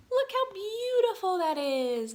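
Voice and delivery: delighted awe. Real, not acted. Teacher showing the class the new pond, in slow delighted awe. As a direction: marvel at the picture.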